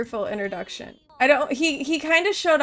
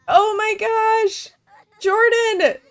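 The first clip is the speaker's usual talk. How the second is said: loud, high, drawn-out wail; anguished outcry; 10 semitones higher, 6 dB louder, slower than usual. Pretend. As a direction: cry out the name in theatrical grief. Crying out over game death.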